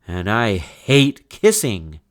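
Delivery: put-on slow loud kid's disgust; emphatic disgust. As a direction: declare it like a grossed-out kid. Child's disgust.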